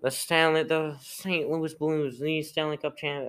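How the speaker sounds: annoying mock announcer, high and stretched; Gary Bettman. Podcaster mocking the league boss announcing the champion, annoying voice. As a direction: drag the words out in an irritating high impression. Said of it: "his voice is really annoying".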